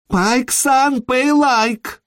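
high-pitched wailing demand; shrieky wail of a woman near hysteria. A narrator's reading. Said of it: "она снова заголосила".

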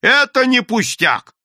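high, loud, slow insistence; emphatic heated protest; crying out. A narrator's reading. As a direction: shout it with drawn-out emphasis. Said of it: "закричал инкассатор".

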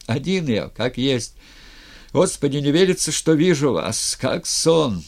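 put-on loud and elated, drunk with joy; noisy joy. A narrator's reading. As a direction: gush with happy disbelief. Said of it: "шумная радость Куприяна".

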